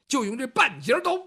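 normal talking voice

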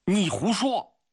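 high-pitched, outraged cry; sudden angry shout. A narrator's reading. Grigory calls the guard a liar, suddenly shouting. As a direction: burst out in accusation. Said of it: "格里高利突然大声地喊了起来".

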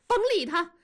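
shrieky dismissal, speeding up at the end; yelling. A narrator's reading. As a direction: holler furiously from the next room. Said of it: "文丽在屋子里吼叫道".

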